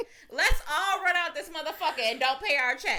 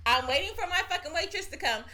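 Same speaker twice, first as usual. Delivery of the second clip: ordinary talk, nothing marked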